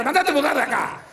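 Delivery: high wife voice shooing the dog; high, sharp wife shooing the dog off. Retold or imitated.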